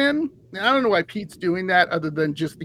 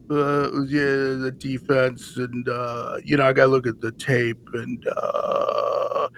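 low, mumbled coach impression; Bill Belichick; 8 semitones lower than usual. Head coach dodging reporters' questions, low mumble. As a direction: mumble evasively, low and trailing into a drone. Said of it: "he'll mumble something".

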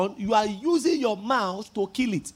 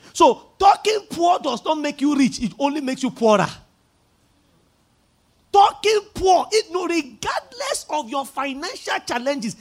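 shouted, louder preaching, pitch up; preaching at full force; 5 semitones higher, 6 dB louder than usual. Acted. Pastor shouting the warning at the congregation. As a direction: thunder it at the congregation.

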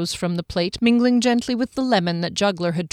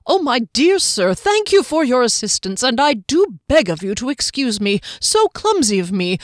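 higher, louder, fussy apologetic little voice; earnest halfling; 5 semitones higher, 4 dB louder than usual. Acted. Halfling apologising earnestly to Juggler, higher and louder. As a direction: gush apologies like a polite flustered halfling. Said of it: "speaking earnestly the whole time".

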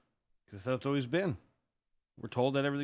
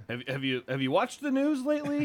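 mock incredulous question; 10 semitones higher, 3 dB louder than usual. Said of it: "you're sitting there going".

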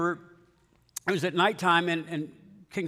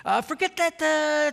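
high, loud, flustered mock plea; hammed-up begging, pitched way up; pleading; 10 semitones higher, 4 dB louder than usual. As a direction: beg with an awkward hesitation. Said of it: "he pleaded".

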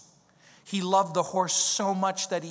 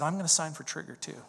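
serious, sad; 3 semitones lower, 7 dB quieter, faster than usual. Retold or imitated. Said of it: "And he said".

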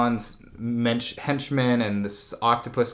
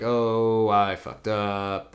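usual speaking voice

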